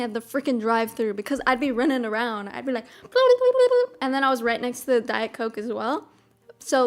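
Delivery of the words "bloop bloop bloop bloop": playful high-pitched bloop sound effects; high bloop noises. Retold or imitated. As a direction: chirp the bloops like a busy drive-thru. Imitating drive-thru sounds.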